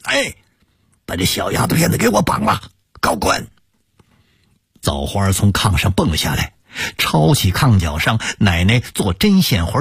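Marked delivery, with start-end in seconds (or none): 0.0-3.5 shouting. A narrator's reading. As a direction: bark the order at the thugs. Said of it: "高喊着".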